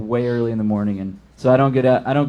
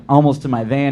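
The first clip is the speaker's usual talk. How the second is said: ordinary delivery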